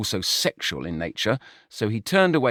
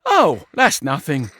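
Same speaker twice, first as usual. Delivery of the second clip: snuffling, dismissive; 5 semitones higher, 3 dB louder than usual. A narrator's reading. George brushing off his brother, mildly dismissive. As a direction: wave it away with a sniff. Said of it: "George snuffled dismissively".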